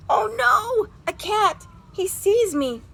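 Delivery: high, loud frightened cry; alarmed. Acted. Little robin spotting a cat, alarmed cry. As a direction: shrink back from the cat in alarm.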